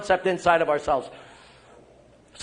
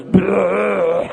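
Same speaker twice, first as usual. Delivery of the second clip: a sodden bleh, half crying; drunken groan; 5 dB louder than usual. Retold or imitated. Drunk priest carried home, a sodden groaning bleh. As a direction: groan like you can barely stand. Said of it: "all I had to say was".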